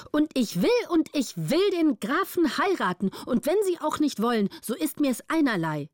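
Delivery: hoch und trotzig, will betont. A narrator's reading. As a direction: die Gräfin trotzt dem Vormund. Gräfin defies guardian. (trotzig beharrend)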